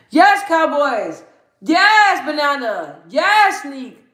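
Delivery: high, drawn-out yes, dropping on each name; excited cheering. Pretend.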